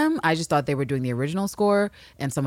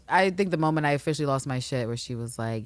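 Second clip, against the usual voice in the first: ordinary talk, nothing marked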